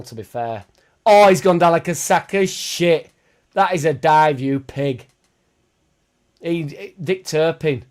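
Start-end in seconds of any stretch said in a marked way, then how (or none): 1.0-3.1 loud, high indignant outburst at the diver; outraged yell. Real, not acted. Fan yelling at a diving footballer, loud and outraged. Player dives on stream. 3.5-7.8 loud scornful jab at the player; angry call-out. Real, not acted. Fan calling out a dive, loud and scornful.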